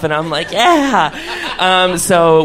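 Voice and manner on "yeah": excited cheer. Retold or imitated. Party crowd cheering along.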